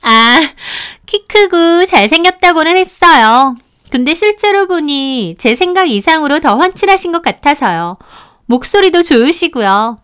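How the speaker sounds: high-pitched, admiring character voice; flirty, gushing friend.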